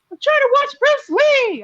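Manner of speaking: put-on loud, very high kid whine; imitated toddler shouting in complaint; little girl's voice. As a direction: mimic a small child yelling, slow and drawn out. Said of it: "I hear this little voice".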